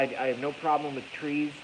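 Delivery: usual speaking voice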